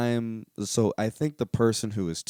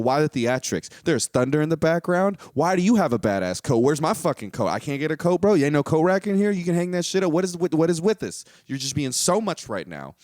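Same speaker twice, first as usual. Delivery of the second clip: yelling at a know-it-all on screen; mock angry rant; 6 semitones higher than usual. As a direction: vent at him, pitch up and push. Acted rant at Morpheus.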